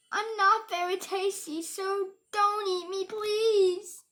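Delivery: high, loud mock pleading. Scared child begs the monster, high pleading. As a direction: beg the monster not to eat you.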